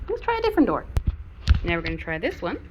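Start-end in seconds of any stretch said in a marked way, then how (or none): 0.1-0.8 very high singsong to dog; high dog talk. Real, not acted. Talking to dog.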